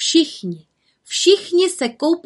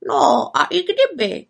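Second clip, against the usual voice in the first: high, slow raven voice; raven character; 7 semitones higher than usual. A narrator's reading. Havran shrugs off the witch's worry, raised raven voice. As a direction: draw it out in a raised bird voice.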